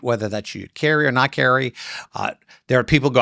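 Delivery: usual speaking voice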